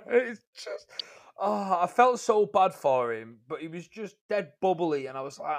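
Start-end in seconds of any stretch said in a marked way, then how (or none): none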